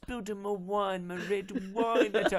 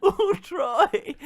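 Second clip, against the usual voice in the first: mock higher swoon; 13 semitones higher, 7 dB louder than usual. Mocking Depp's envy.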